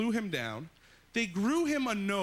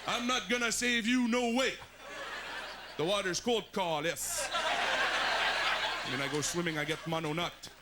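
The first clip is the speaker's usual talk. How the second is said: Quebec lifeguard; 5 semitones higher than usual. Retold or imitated. Lifeguard yelling at an English swimmer, Québécois accent.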